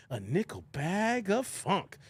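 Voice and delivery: slow, stretched-out song lyric; drawn-out lyric quote. Retold or imitated. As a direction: draw out each word like a groovy hook. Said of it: "Remember Digable Planets?".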